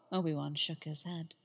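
ordinary delivery

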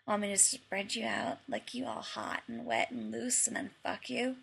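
soft, husky murmur. A narrator's reading. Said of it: "John asked huskily".

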